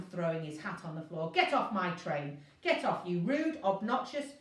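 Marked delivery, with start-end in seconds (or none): 1.3-4.3 furious order. A narrator's reading. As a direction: throw her off the train in a rage. Inspector throws her off.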